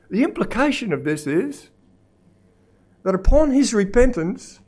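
high, loud, emphatic sermon; full-force preaching. Real, not acted. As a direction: drive each phrase home from the pulpit.

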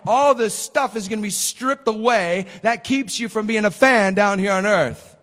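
usual speaking voice

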